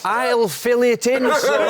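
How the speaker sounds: high, slow mock Brian Clough accent. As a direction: play the manager dismissive, drawn out. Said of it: "retold manager".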